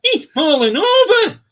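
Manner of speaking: very high, loud, slow funny voice breaking into laughter; mock impaired man's punchline, laughing; speech impediment. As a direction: squeal it out while cracking up. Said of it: "And he goes".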